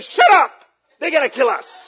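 high, loud acted alarm; alarmed yell, played for laughs; panicked shout. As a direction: shout her down in a mock panic. Acts out panicked hushing of screamer.

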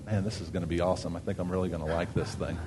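quick under-the-breath remark; muttered aside. Real, not acted. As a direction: drop the voice, as if talking to yourself. Aside about the new clicker.

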